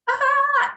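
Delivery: high mock yelp; falling cry. Storyteller's fingers slip off the tree, mock falling cry. Re-enacts losing grip and falling.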